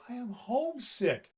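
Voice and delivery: high sobbing doll voice. A narrator's reading. Doll sobbing to Santa, high and tearful. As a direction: wail it out like a homesick little doll. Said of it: "sobbed Lady Arabella".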